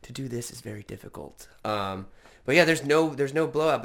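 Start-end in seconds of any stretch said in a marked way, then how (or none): none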